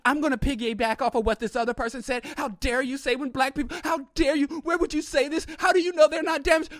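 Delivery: rising, high mock indignation; indignant challenge. Second audience member piles on the panelist, rising mock indignation.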